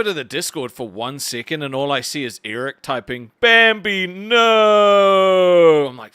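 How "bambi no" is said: higher, louder mock cry of alarm; reading out a friend's message as a wail. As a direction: ham up the dramatic wail. Reading friend's Discord message aloud.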